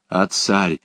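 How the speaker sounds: ordinary delivery, nothing marked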